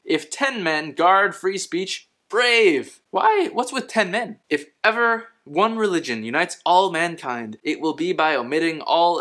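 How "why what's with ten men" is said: sharp high puzzled why; baffled. Real, not acted. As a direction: squeak it out in disbelief. Baffled by the ten men.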